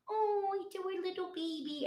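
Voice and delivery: put-on high, cooing voice like talking to a baby; mock baby talk. Mocking people who treat teens like babies, cooing. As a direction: coo it like over a crib, mocking.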